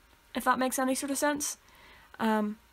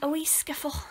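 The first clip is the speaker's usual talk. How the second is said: whisper; 4 semitones higher, 3 dB quieter than usual. Shown as a demonstration. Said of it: "my Northern Irish word of the week".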